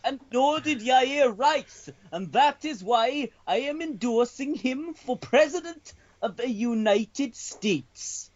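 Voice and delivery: high, slow Welsh newsreader voice; bad Welsh accent, sing-song and solemn. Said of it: "it has to be a Welsh newsreader".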